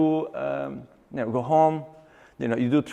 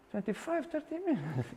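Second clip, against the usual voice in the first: astonished echo; 6 semitones higher, 15 dB quieter than usual. Retold or imitated. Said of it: "trainer repeats in disbelief".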